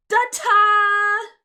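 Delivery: very loud, high fanfare cry; triumphant ta-da. Acted. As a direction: belt it like a showgirl. Miracle's grand pose.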